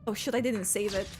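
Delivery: ordinary talk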